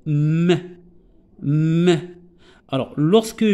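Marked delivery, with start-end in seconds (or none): 0.1-2.1 "m" hummed long with a short vowel after; letter sound. Shown as a demonstration. Teacher sounding the consonant alone for pupils, "m" as "mmm-e". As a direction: sound out "m", repeated after a pause. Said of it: "je vais la prononcer".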